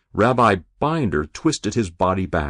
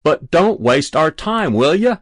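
raised, higher voice of irritation; impatient call; 8 semitones higher, 6 dB louder than usual. A narrator's reading. Calling to the boy not to waste time, impatient. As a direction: call out loudly, fed up.

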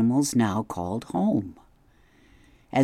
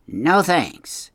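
put-on a higher, curt refusal from an old beaver; gruff beaver; 5 semitones higher, 4 dB louder than usual. A narrator's reading. Mister Beaver turns down the robot's gift, curt. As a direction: brush the offer off fast.

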